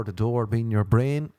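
ordinary talk, nothing marked

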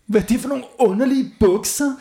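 put-on high shocked girl voice; outraged child. A narrator's reading. Girl horrified by ugly trousers. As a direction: squeal it in disgust. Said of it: "råbte Gurli".

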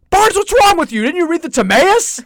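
mock the teacher's exasperated disbelief; scolding professor. Advisor scolding the student Barnes, high exasperated mock outrage.

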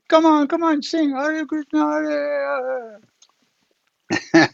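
very high coaxing chant, fading off; lama urging song as chant trails off; Rinpoche urging. Retold or imitated.

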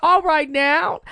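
high, laughing hype; excited host. Acted. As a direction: hype it like a talk show host. Jokes she's Oprah.

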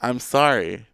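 usual speaking voice

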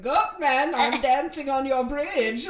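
loud, drawn-out goofy taunt; sing-song dare called out slowly; Shane impression. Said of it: "Shane going".

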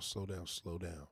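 telling a fast-talking New Yorker to ease up, slow and soft; slowed-down calming. Shown as a demonstration. Easing off, slow and quiet. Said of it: "you gotta say it just like that".

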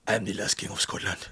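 lightly voiced low, soft Forrest Whitaker as Idi Amin; a quiet, hushed hint of the dictator from the film; a soft, half-hearted stab at Whitaker's Idi Amin; Forrest Whitaker impression. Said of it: "my impression of Forrest Whitaker".

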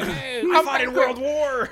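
put-on old man voice. Playing the old grandpa.

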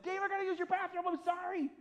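high, loud, frantic rush of words; urgent squeal of a man who needs a toilet now; panicked plea. Retold or imitated. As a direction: blurt it out in a desperate hurry. Panicked dash to friend's bathroom.

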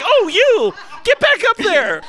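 high giggly imitation, laughing through it; laughing mock voice. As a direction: squeal it out while laughing. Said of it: "she's like".

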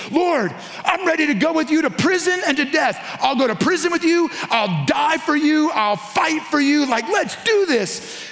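high, eager vow of loyalty. Retold or imitated. Peter boasting to Jesus he'll die for him, high and eager. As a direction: promise it like a pumped-up friend. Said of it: "and he goes".

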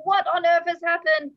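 mother bursting in, yelling; retold yelling mother. Mother running in after baby falls, mock yell. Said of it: "she comes yelling and screaming".

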